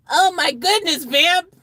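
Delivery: high, loud theatrical shock; shocked exclamation. Acted. Redoes exclamation in changed voice.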